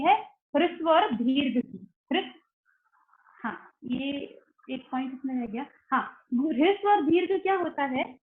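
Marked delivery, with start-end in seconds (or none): none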